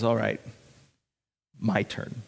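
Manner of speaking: low, soft and unhurried; understated confidence. Acted. Elijah after the prophets fail all day, quietly assured. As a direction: quietly take over with a pause midway. Elijah calmly takes his turn.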